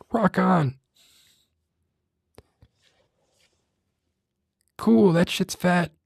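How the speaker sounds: squeaky high parody ASMR host; high mock ASMR voice. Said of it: "what sounds like a smoker's voice".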